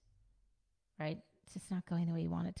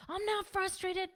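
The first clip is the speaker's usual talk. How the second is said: put-on much higher, slow, pouty kid voice; sulky child; 13 semitones higher than usual. Child denying being frustrated to a parent, sulky and pouty. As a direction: pout it out like a cross little kid.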